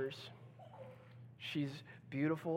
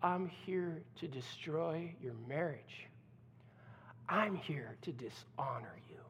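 smirking threat; 8 dB quieter than usual. Acted. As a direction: purr the menace, savoring it. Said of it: "the woman smirks and looks at her and says".

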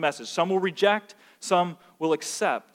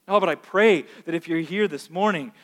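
ordinary delivery

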